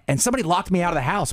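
ordinary delivery

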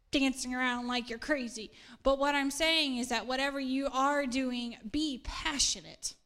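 ordinary delivery

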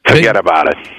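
tough-guy New Jersey catchphrase; hammed-up New Jersey accent; mock Jersey accent. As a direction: put on a thick Jersey accent. Mock East Coast accent catchphrase.

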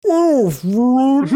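slowed-down drawn-out shout of Aphrodite; slow motion. Retold or imitated. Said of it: "Meryl Streep slows down and goes".

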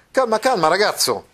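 high, loud calming cry; Mastro Pietro scolding. Acted. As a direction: rein in the excited boy. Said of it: "s'udì ... la voce di Mastro Pietro". (Mastro Pietro che richiama il ragazzo ad alta voce)

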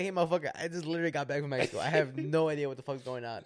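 hammed-up indignant girl firing back; mock outburst. Comedian imagining the worker snapping at customers, mock loud indignation.

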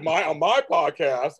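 "my" drawn out slowly with mock pride; teasing a co-host, slow mock emphasis on "my"; slow mocking stress on my. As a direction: stretch each "my" slowly to tease a friend. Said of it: "Hello, he said".

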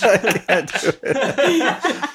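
breaking up with laughter; high laugh through the words. Real, not acted. Cracking up mid-bit.

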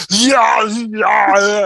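high, loud mock "yeah, yeah" yell; hammed-up dubbed battle cry; imitating Arnold's dubbed yell. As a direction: shout it like a badly dubbed hero. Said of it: "and you hear".

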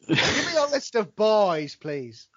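put-on your list of boys, drawn out slowly; a slowed, teasing plea; slow mock demand. Asking Constance for her list, slow playful plea. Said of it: "can you say".

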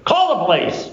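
high-pitched defiant call-out; defiant mock shout. Acted defiant drunk in a bar.

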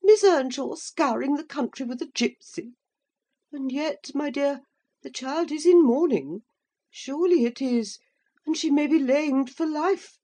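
put-on high fussing old lady; shocked matron clucking over the child; a lightly voiced old lady. A narrator's reading. Mrs Linton frets to her husband about the lamed girl, high and fussy.

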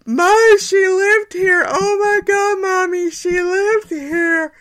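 high, loud, slow tearful wail of joy; crying happy tears. Retold or imitated. A grown woman crying to her mom in the road, high tearful wail. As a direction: sob it out like an overwhelmed child. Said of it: "crying happy tears, going".

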